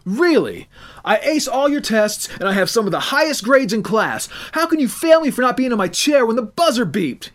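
outraged student arguing back; challenging teen. Acted. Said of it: "he challenged".

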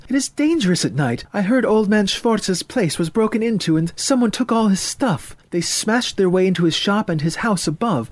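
high, agitated mother voice; worried mother getting worked up, pitched high; fretting mother. Acted. Mother warns son of break-ins at night, fretting.

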